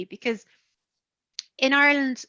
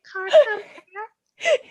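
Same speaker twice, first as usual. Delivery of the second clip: "Cork vampire" very slow and high, "Cork" drawn out as "Kark"; Cork accent; 10 semitones higher, much slower than usual. Retold or imitated. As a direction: stretch "Cork" like a Cork local. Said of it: "Oh God, they would say it that way".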